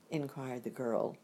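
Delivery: ordinary delivery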